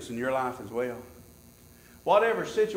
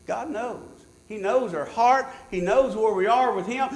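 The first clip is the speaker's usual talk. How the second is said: ordinary talk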